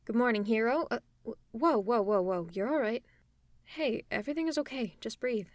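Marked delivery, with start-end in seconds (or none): none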